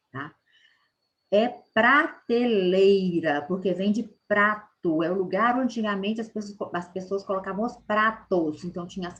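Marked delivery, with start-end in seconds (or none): 1.3-3.4 prateleira drawn out slowly; correct form, slow. Shown as a demonstration. Teacher giving the right word, slow. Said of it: "é prateleira e não partilheira".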